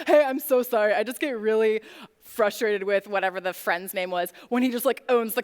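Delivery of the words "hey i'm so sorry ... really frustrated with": a light mock dopey guy, barely put on; dumb guy voice. Quoting her silent date's text in a lightly mocking dumb guy voice. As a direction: play the date as a lightly voiced dim guy. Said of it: "this is my dumb guy voice".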